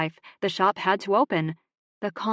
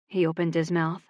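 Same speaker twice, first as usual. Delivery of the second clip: ordinary delivery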